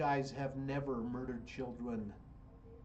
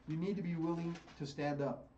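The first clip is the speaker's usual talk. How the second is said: ordinary delivery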